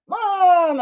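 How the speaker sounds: high, loud complaining Mom; whiny protest. Retold or imitated. Son protests.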